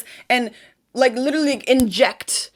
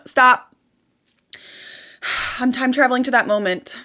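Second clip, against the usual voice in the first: ordinary delivery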